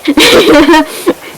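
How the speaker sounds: real high burst of laughter. Real, not acted. Laughs after grim ending.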